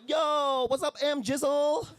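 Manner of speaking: high, drawn-out bro greeting; mock street slang greeting; mock coworker greeting. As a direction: hype up a buddy in a silly voice.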